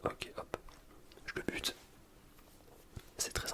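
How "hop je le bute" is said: ordinary talk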